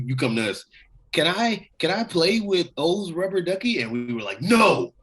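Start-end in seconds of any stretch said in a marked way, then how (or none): 1.1-3.8 lightly voiced pleading kid. Brother asking to play. 4.4-4.9 mock firm refusal. Refusing the brother.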